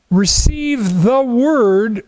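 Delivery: "the Word" stretched out very slowly; slow right down to land on "the Word"; deliberate, drawn-out "the Word"; stress on Word. Real, not acted. Points up repeated Word in verse.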